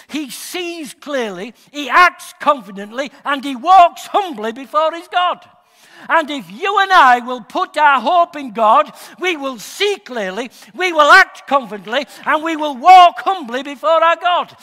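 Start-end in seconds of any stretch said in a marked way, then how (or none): 6.1-14.6 full-force preaching, loud and rising. Acted. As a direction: drive the promise home with a big voice. Preaching climax.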